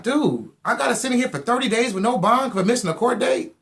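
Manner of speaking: high incredulous complaint; can't believe the sentence, voice rising; outraged disbelief. Retold or imitated. Jailed without bond, high outraged disbelief. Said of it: "I'm like".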